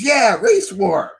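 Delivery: high, drawn-out gleeful yeah; imitated eager confirmation. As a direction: confirm it with mock cheer. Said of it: "She goes".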